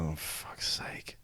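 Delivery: whispered under the breath, slow; hushed, drawn-out aside to oneself; exasperated whisper. Retold or imitated. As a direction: breathe out a weary private curse. Silent reaction to a drug deal.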